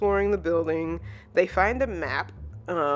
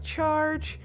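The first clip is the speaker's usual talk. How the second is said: high, held-out rallying call; mock battle cry; 10 semitones higher than usual. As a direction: imitate an eager charge without shouting. Voicing Anya's eager battle cry.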